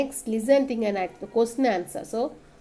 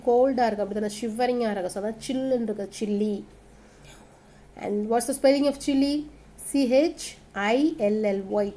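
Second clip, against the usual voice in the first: usual speaking voice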